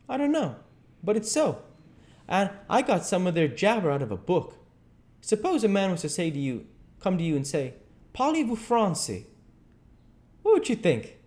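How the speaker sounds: put-on high, animated boyish voice; Huck, eager. Huck tries book French on Jim, eager.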